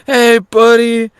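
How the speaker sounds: sloppy high-pitched coo to the family dog; drunk baby talk to dog. Retold or imitated. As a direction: coo at the dog like a wasted man. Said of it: "and I was apparently like".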